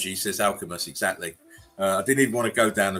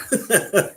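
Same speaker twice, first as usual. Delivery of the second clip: high laugh; 12 semitones higher, 6 dB louder than usual. Real, not acted. Laughing at chat.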